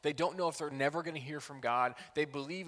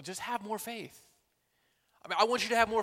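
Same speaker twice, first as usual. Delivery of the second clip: lightly mocking, higher-pitched advice; mock pat advice; 7 semitones higher, 6 dB louder than usual. Mimics people's too easy advice.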